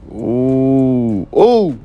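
car engine thrill; excited ooh. Real, not acted. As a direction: let it climb as the engine starts. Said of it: "starting the new car".

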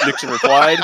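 ordinary talk

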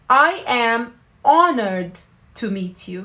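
slow phrase with honored stressed; slow teaching model. Shown as a demonstration. Teacher modelling honored to meet you slowly. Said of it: "فبنحكي".

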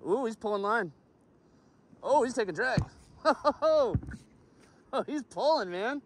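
high, breathless excitement while reeling; thrilled as the fish runs; fighting a fish. Real, not acted. Reeling in a fish that takes drag.

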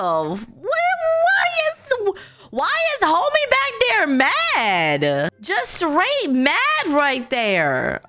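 high, drawn-out disbelief; incredulous exclamation. Real, not acted. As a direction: stretch it out high, baffled. Spots an angry bystander.

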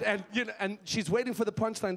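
ordinary talk

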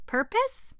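very high astonished query; surprised question. Acted. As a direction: correct him, amazed. Alice correcting, surprised.

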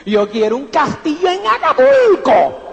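voz cambiada, alargando mi sueño despacio; remedo soñador exagerado. Retold or imitated. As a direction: imita al upline que sueña a lo grande. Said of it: "me decía". (imitando al upline soñador con voz cambiada)

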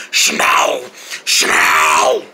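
low growly animal snarl; deep pretend beast growl; mock snarl. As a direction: snarl the word back in imitation. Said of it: "I can snarl just like you".

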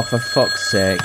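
ordinary talk, nothing marked

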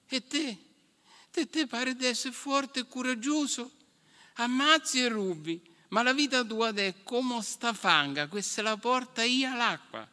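put-on high child's voice, serious warning; a small boy lecturing a grown knight; scolding child. A narrator's reading. Dream boy warning the knight about his violent life in a high voice.

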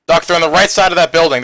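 urgent loud warning; shouted callout. Real, not acted. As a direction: yell it across to the medic. Warning medic in firefight.

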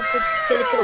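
high, squealed chalo over and over; excited urging. Pretend. Kid playing with toys urges them on with high squeals. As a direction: cheer the toys along, squealing.

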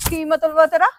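loud rising challenge; sharp demand. Acted. Girlfriend confronting her boyfriend, loud and alarmed.

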